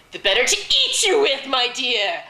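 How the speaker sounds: high, loud storybook pounce; triumphant wolf. A narrator's reading. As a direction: burst out loudly, going in for the kill. Wolf reveals itself and attacks.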